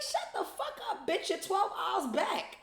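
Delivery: high-pitched mocking snap, laughing through it; mock her with a high laughing comeback; teasing high-voiced put-down; high mocking retort. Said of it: "I'm like".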